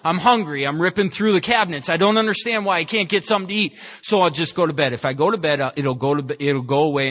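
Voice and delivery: ordinary talk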